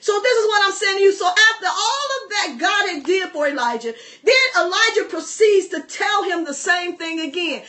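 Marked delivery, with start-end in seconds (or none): none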